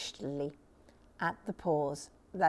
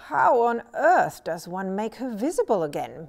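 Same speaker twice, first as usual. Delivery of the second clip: mildly anxious, a bit louder question; a worried father at a loss; 2 semitones higher, 8 dB louder than usual. A narrator's reading. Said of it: "said worriedly".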